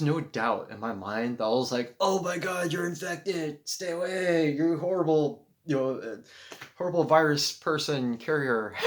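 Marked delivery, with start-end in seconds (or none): 2.0-8.7 high, loud, hammed-up horror; exaggerated frantic warning, clearly joking; mock panic. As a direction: pretend to recoil in alarm from contagion. Mock horror at infected hugger.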